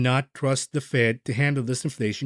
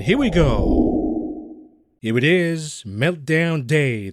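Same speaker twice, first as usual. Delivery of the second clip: dramatic announcement; 2 semitones higher, 4 dB louder, much slower than usual. Real, not acted. Dramatic market close reveal.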